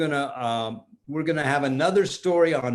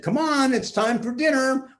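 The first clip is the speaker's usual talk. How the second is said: calling to dinner; 9 semitones higher than usual. Acted. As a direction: call the kids in to eat. Parents call kids to dinner.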